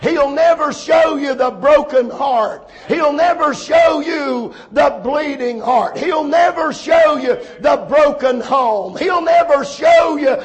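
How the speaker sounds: emphatic preaching in punched, repeated phrases; fervent preaching. Real, not acted. Preacher warning young people and adults about sin, fervent preaching. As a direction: drive each phrase home to the congregation.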